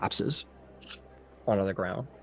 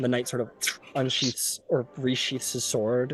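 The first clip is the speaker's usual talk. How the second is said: ordinary talk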